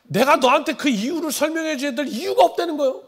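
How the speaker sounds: high, loud, indignant mock-father voice; father's refusal. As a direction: refuse to explain, imitating an exasperated father. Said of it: "나는 우리 아버지한테". (설명을 거부하는 아버지를 높고 크게 흉내)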